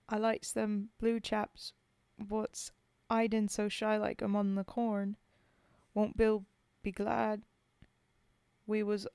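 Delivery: put-on poem read in a rough British soldier's accent. Streamer reading Bill's Grave to chat, in a British soldier's accent. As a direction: tell it as a homesick Tommy at the grave.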